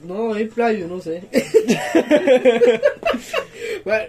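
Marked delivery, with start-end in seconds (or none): 1.3-3.8 long, loud laugh; real helpless laughter; burst of laughter. Real, not acted. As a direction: laugh out loud, higher than speech. Friends laughing over who cries.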